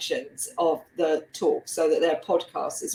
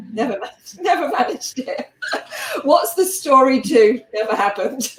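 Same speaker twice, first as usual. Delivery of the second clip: speaking through real laughter, higher pitched; helpless amusement breaking into the words; laughing; 5 semitones higher, 4 dB louder than usual. Real, not acted. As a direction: laugh at your own failed plan. Laughing at own unwritten second book.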